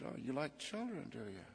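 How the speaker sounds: soft, sly retold question, dropping at the end; coy mock interest. Single father hinting to Sabrina, coy soft interest. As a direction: feign casual interest, softly and knowingly.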